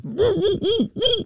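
high breathless woo-woo hooting; gasping laughter. Retold or imitated. Craig trying not to laugh in class, breathless hoots. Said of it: "I couldn't breathe".

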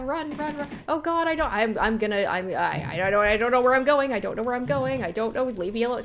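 loud, stammering panic; real panic. Real, not acted. Player lost while being chased, babbling in panic. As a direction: trip over the words in a loud panicked rush. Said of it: "I'm panicking".

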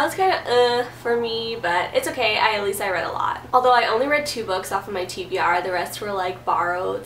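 normal talking voice

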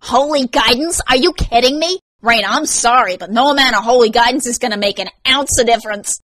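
loud, high incredulous echo; mocking amusement. A narrator's reading. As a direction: ridicule it in gleeful disbelief. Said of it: "Vance had never laughed so hard".

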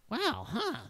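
a nervous gunman setting terms; wary NPC voice. Acted. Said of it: "he's like".

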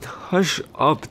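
embarrassed, telling her to hush; flustered hush. Acted. Husband flustered by his wife's teasing, slow and high.

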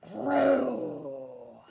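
loud growl fading out; tiger growl. A narrator's reading. Tiger growling at Theodore, loud drawn-out growl. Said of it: "It growled at Theodore".

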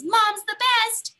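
high, loud and slow with lots of feeling; super excited. Shown as a demonstration. Teacher showing kids how to read an exclamation mark. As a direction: gush it with real delight. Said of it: "super excited way with lots of feeling".

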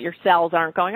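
ordinary talk, nothing marked

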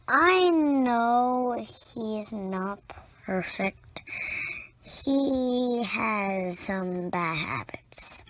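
plain reading voice

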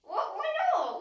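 loud, high indignant shock. Real, not acted. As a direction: shoot it out in disbelief. Shocked at wrong answer.

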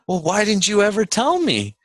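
high-pitched retold complaint; mock-indignant why-me question; indignant protest. Acted protest to parents.

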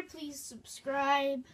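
drawn-out, slow plea. Acted. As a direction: stretch out the request.

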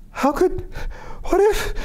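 very high, broken-off flustered fragments; Jenny after the fall, sputtering in shock. A narrator's reading. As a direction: splutter in shocked disbelief. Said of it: "Jenny sputtered".

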